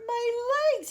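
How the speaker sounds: high, loud anguished cry; centipede crying out. Acted. Centipede crying out as paint glues his legs. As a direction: howl about the stuck legs. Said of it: "he cried".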